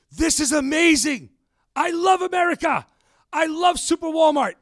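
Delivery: loud, high, gushing with wonder as the retold friend; amazed delight. Spanish friend marveling at super Walmart, loud excited gush. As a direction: burst out in a friend's overjoyed amazement.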